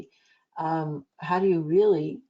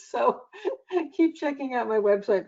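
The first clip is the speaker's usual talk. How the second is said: laughing; 6 semitones higher than usual. Real, not acted. Laughing after own joke.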